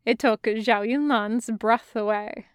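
usual speaking voice

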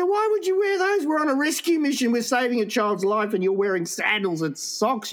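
high-pitched exasperated telling-off; mock scolding. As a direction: act out berating a friend in disbelief. Retold scolding over silly footwear.

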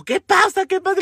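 high, urgent questioning; acted-out high-pitched alarm; alarmed mother. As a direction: imitate a mother startled by screams.

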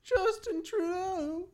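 very high, slow mocking echo of the name. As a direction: stretch the name in a silly high voice.

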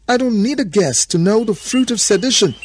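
high, loud accusation; furious Adam. A narrator's reading. Adam recognising the fruit of sedition, furious shout. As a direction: rage at her in a high shout.

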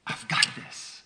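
breathy, hushed "I've got this"; hushed voice of God reassuring Alan; soft whisper. Retold or imitated. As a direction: whisper it like a gentle promise. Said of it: "He said".

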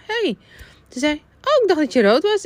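high, light surprised voice; manager voice. Retold or imitated. Manager's quoted remark.

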